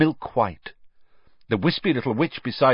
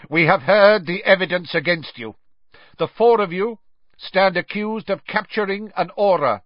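raised, high-pitched judge's delivery; loud pronouncement; 6 semitones higher, 3 dB louder than usual. A narrator's reading. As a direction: read the charges out over all interruption. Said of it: "speaking more loudly, drowning out his son's voice".

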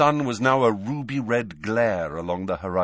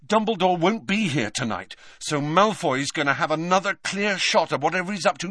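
high-pitched, pressing explanation; urgent Harry; 8 semitones higher than usual. A narrator's reading. Boy warning his friends before leaving, urgent. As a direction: press the danger on them.

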